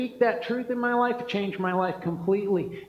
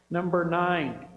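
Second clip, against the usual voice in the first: normal talking voice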